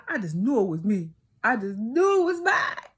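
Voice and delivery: so sure it was hers the pitch leaps; rising excitement. Real, not acted. Telling viewers she knew the lottery was hers, rising excited. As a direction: voice climbing high on the second I just knew. Building certainty about winning.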